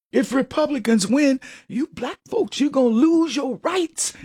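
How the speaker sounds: mock pandering voice. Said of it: "And they talk like that".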